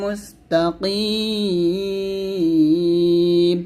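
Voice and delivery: slow chant, Mustakim drawn very long; Quran recitation. Shown as a demonstration. Teacher reciting the verse for students. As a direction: stretch the last word melodically. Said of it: "এরকম".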